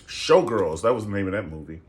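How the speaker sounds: ordinary talk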